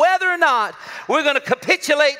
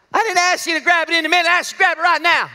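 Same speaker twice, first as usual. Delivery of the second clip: exasperated parent; 6 semitones higher, 4 dB louder, faster than usual. Retold or imitated.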